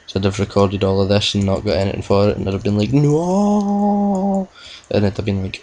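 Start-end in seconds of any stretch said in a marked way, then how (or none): 2.9-4.5 anguished no. Pretend. Imagined despair at lost recording.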